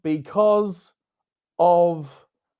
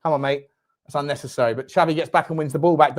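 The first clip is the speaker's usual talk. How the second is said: normal talking voice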